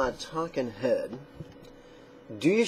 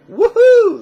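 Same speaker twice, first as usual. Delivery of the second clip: high, loud woohoo; cheering; 15 dB louder than usual. Real, not acted. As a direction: whoop with excitement. Cheering before starting.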